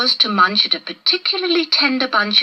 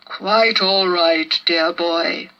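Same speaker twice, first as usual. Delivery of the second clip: slow, fond giant's reply; beaming kindly; 3 semitones lower, much slower than usual. A narrator's reading. Brontosaurus reassuring the rescued boy, slow and beaming. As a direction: beam at the boy, unhurried. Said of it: "beamed Bron".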